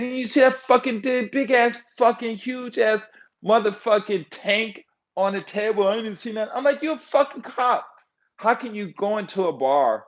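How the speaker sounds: high-pitched exasperated tirade; incredulous, high retold rant; cussing him out. Cussing out his cop friend for missing the gun, exasperated tirade. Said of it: "And I cussed that motherfucker out".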